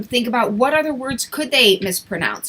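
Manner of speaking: usual speaking voice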